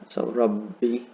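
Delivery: rabbi with the BB held; doubled B. Shown as a demonstration. Teacher showing students the shadda in rabbi. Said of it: "another shadda over rab".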